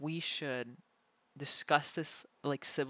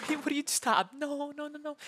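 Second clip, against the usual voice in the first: laughing protest; 10 semitones higher, 6 dB louder than usual. Real, not acted. Being picked up, tickled.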